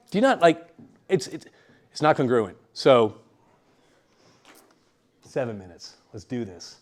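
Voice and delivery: ordinary talk, nothing marked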